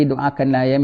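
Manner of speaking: ordinary talk, nothing marked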